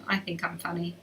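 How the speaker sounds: sheepish whisper to herself; self-teasing whisper after laughing; whispered aside. Real, not acted. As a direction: whisper it like a private joke. Self-mocking aside after joke.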